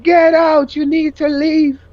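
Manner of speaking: shouting him out of the house; screaming. Retold or imitated. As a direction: retell throwing him out. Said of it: "I started screaming".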